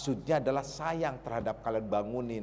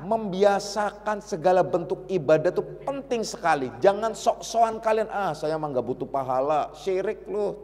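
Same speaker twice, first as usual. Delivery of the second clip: higher, louder, urgent sermon voice; forceful preaching; 5 semitones higher, 3 dB louder than usual. Acted. Preacher urges the listeners to worship sincerely, with raised voice. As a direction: press the point home.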